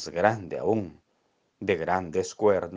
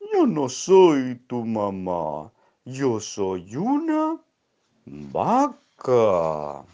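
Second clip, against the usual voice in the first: hohe, sehr langsame, laute Kuh; Kuhstimme; 3 semitones higher, 5 dB louder, much slower than usual. Acted. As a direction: zieh jedes Wort lang wie eine gemächliche Kuh. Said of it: "Y le dijo".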